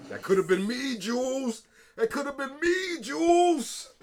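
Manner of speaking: loud, high theatrical wail; shouted, high-pitched dramatic lament; mock outcry. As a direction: cry it out in hammed-up protest. Mock outcry from the show retold.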